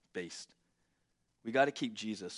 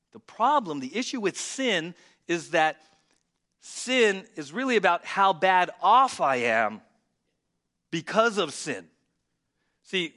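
preaching at full force; 4 semitones higher, 7 dB louder than usual. Acted. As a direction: preach it like a pastor driving the message home to the congregation. Preacher drives home point.

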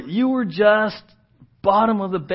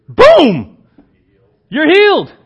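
explosive high shout, then a loud declaration; booming proclamation; 10 semitones higher, 13 dB louder, slower than usual. Acted. What Jesus didn't say to the lepers, booming proclamation. Acts the instant healing not said.

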